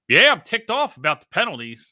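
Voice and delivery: lightly mocked press-conference coach. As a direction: imitate a coach declaring he's ticked at a press conference. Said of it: "him sitting in the press conference being".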